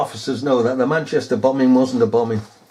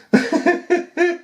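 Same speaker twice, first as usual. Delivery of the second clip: high-pitched giggling; amused laugh; 13 semitones higher than usual. Real, not acted. Recalling the run-in in Chester, high giggling. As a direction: burst into real giggles. Remembering the officer footage.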